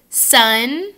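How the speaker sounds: "sun." with a front N. Shown as a demonstration. Teacher drilling sun against sung, front N. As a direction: end "sun." on the tongue tip. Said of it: "let's say sun".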